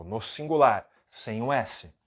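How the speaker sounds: usual speaking voice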